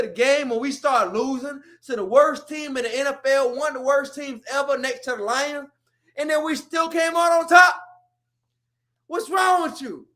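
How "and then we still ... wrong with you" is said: raised, higher exasperated yell; loud disbelieving rant; exasperated shout. Real, not acted. Ranting at AB's outburst.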